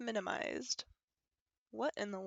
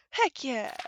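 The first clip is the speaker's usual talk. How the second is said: falling, emphatic "Heck yeah."; firm; 3 semitones higher, 6 dB louder than usual. Pretend. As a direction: state "Heck yeah" with conviction. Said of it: "Don't copy me".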